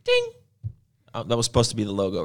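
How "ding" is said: high, short mock bell ding; imitated ding. Shown as a demonstration. Host fills in for the on-screen logo, imitated ding. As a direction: make the sound of a pop-up chime.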